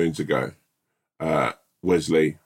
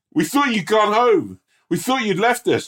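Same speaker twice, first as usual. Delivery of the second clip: much higher, hammed-up hurt surprise; mock soldiers; 4 dB louder than usual. Squad greeting the late hero, high mock reproach. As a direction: whine it like abandoned squaddies. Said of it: "we'd be like".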